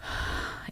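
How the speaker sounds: long tired exhale; deep sigh. Shown as a demonstration. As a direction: let out a heavy sigh. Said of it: "you hear the sigh".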